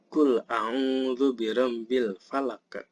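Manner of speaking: slow recitation, echoing q ending "falaq"; drawn-out verse, "falaq" released as falaq-eh; qalqalah at verse end. Shown as a demonstration. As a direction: sound out the final q of "falaq" with a bounce. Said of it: "di akhir ayat ada qalqalah".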